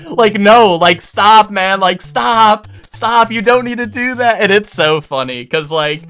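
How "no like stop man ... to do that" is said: raised, louder begging to stop; retold alarmed pleading. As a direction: urgently wave him off, higher. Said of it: "this guy's like".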